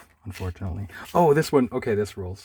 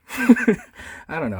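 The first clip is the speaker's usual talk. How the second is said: breaking up with laughter, high and slow; can't hold in the laugh; 8 semitones higher, 6 dB louder, much slower than usual. Real, not acted. Reader cracking up at the comic, laughing. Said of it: "I just kinda love".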